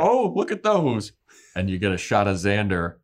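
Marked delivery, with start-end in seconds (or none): 0.0-1.1 high, louder, pleased gasp of discovery; delighted surprise. Retold or imitated. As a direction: squeal it like a happy find. Willow notices her corset.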